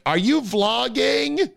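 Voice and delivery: slow, high-pitched mocking voice; mocking onlookers. Host mimics people behind his GoPro, mocking and high. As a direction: draw out nosy questions in a high mimicking voice.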